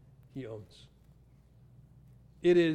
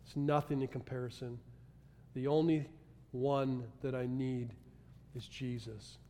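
quiet devotion; 5 semitones lower, 9 dB quieter than usual. Retold or imitated. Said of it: "and go".

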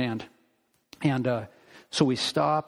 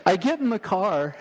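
usual speaking voice

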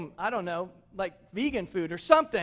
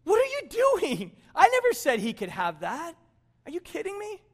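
hammed-up outrage, voice leaping up in pitch; indignant older brother; 7 semitones higher, 6 dB louder than usual. Older brother objecting over his fattened calf, acted indignation. He's not mad.

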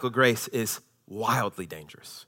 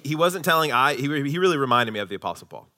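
ordinary delivery

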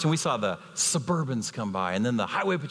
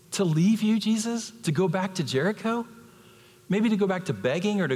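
incredulous Bartimaeus; 8 semitones higher than usual. Retold or imitated. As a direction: scoff at the very idea. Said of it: "that Bartimaeus presents".

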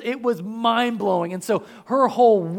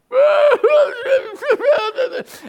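high, loud laughing outburst; burst of laughter; 6 dB louder than usual. Real, not acted. Laughing at bawling Mary.

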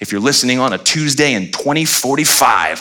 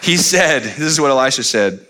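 usual speaking voice

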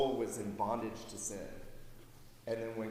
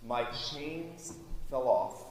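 slow, fading hymn recital; reciting hymn lyrics; 4 dB quieter than usual. Acted. Preacher reciting the hymn's conversion verse, slow and fading. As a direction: let each line sink in slowly. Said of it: "He said".

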